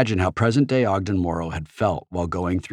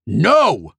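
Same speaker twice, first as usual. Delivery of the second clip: loud bratty tantrum no; furious shout; 7 semitones higher, 6 dB louder than usual. A narrator's reading. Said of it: "Halliday shouted, suddenly furious".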